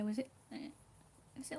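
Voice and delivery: ordinary delivery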